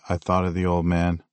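usual speaking voice

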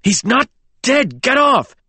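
very high, frantic outburst; desperate protest. A narrator's reading. James pinned on the dock, desperate outburst. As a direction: fight to be released.

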